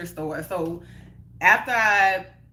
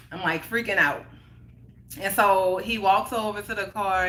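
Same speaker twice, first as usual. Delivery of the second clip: usual speaking voice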